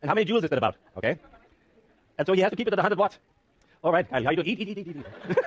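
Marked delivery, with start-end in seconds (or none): 4.5-5.5 laughing urging. Real, not acted. Urging volunteer to eat, laughing.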